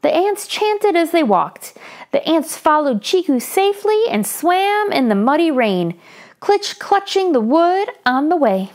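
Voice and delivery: put-on lively read-aloud for children; story narration. A narrator's reading. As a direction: tell it brightly to young listeners.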